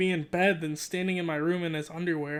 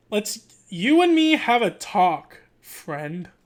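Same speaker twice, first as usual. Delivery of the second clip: dad's voice; 7 dB louder, much slower than usual. Acted. Dad marches the kid upstairs for a talk, mock-stern dad voice. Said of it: "that's not the dad's voice".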